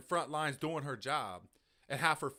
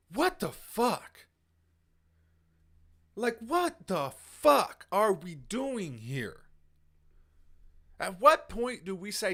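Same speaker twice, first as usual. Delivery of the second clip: slow, drawn-out, higher and louder; exasperated slow outburst; outraged disbelief; 4 semitones higher, 5 dB louder, slower than usual. Real, not acted. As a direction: let the disbelief stretch out each word. Outraged rant about vaccine injury.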